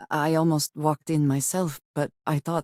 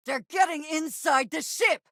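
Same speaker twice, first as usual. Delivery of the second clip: high, urgent warning cry; panicked call to the crew; alarmed shout; 13 semitones higher than usual. Acted. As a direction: sound the alarm as enemies break in. Boarders breaching the ship.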